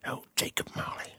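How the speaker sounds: whispered dramatic Scrooge; Michael Fassbender. Acted. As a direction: whisper it gravely, like a serious film actor. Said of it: "I'm trying to do".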